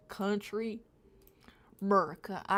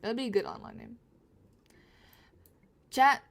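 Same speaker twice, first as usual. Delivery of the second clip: ordinary talk, nothing marked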